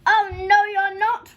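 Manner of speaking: very loud, high troll roar; enormous outraged troll bellow. A narrator's reading. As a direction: roar the refusal at full force. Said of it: "roared the troll, even louder than before".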